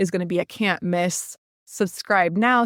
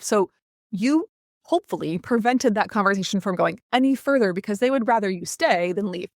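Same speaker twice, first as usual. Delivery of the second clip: normal talking voice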